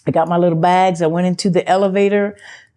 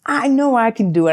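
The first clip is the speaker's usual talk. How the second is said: self-pep talk; 8 semitones higher than usual. Retold or imitated. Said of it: "I said".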